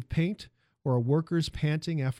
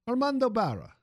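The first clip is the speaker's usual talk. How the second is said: put-on cheerful Italian accent intro; 9 semitones higher, 3 dB louder than usual. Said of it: "the music of the man's accent".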